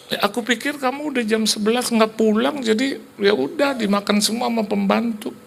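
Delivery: high-pitched whiny excuse; imitated wife. Wife explains the missing food to husband, high imitated excuse. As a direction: plead high, as the put-upon wife.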